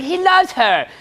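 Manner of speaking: high weepy insistence; hammed-up lovesick protest; mock tearful woman. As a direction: wail it in a woman's defense. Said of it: "It's like".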